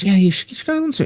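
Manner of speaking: high, quick coaxing friend voice; pushy friend. Retold or imitated. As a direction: nudge him to come along.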